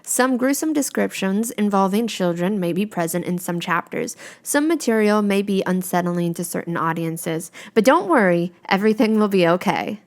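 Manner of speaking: loud, mock-ominous content warning; announcer warning. Narrator warning listeners before the chapter, in a loud mock-ominous voice. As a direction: read it like a spooky trailer disclaimer.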